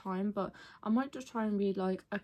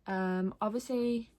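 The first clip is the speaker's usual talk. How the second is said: ordinary delivery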